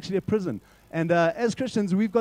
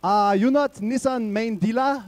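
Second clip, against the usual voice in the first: put-on Chinese accent; 5 semitones higher, slower than usual. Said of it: "and he goes".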